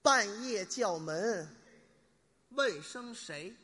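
very slow, drawn-out words with a pause between phrases; slow riddle recitation. Shown as a demonstration. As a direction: pose the riddle slowly, stretching each phrase. (慢慢拖长音念灯谜)

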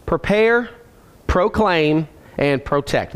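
each word set apart by a clear pause; deliberate repeat for people writing it down; slowed for note-takers. Real, not acted. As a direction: dictate the list slowly, word by word. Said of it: "I'm slowing down for the note takers".

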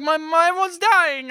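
high, slow sing-song mock; mocking the hero. Mocking the hero's line.

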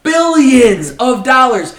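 slow, loud and punched; emphatic loud. Real, not acted. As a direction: hammer every word home. Hammers home the hedge funds' losses.

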